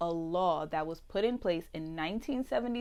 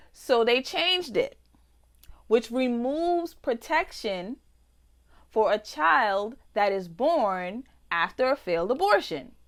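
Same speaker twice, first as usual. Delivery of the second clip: outraged reading; 3 semitones higher, 5 dB louder than usual. Real, not acted. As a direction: read it like an accusation. Building outraged reading of bill.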